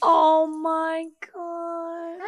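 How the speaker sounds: slow, high drawn-out coo. Real, not acted. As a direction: melt over the dog. Cooing at dog.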